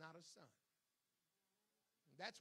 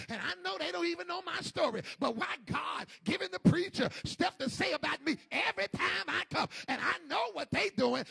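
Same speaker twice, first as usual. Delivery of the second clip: high, loud aggrieved wail; shouted complaint of a churchgoer; 7 semitones higher, 21 dB louder than usual. Acted. Church member shouting grievances at God, high and loud. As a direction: protest like the wronged one at the back pew.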